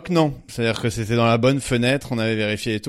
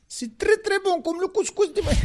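put-on much higher North African accent. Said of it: "Je croyais qu'on avait le droit aux accents".

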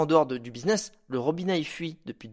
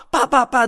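coups de hache; 8 semitones higher, 7 dB louder than usual. Retold or imitated. Bûcheron qui coupe un deuxième arbre.

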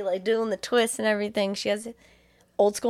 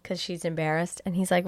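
normal talking voice

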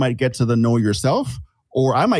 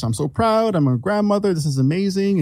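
proud grandmother; 6 semitones higher than usual. Retold or imitated. Mother's joy in delivery room.

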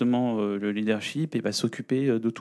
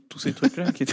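en riant; 5 semitones higher than usual. Real, not acted. Souvenirs drôles, rire en parlant.

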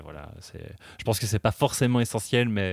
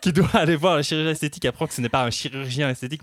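ordinary delivery

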